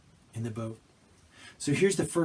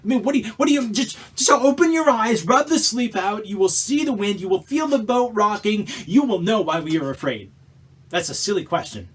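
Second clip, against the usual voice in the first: loud, higher, incredulous exasperation; exasperated disciple; 7 semitones higher, 7 dB louder than usual. Acted. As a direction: vent at a sleepy Jesus.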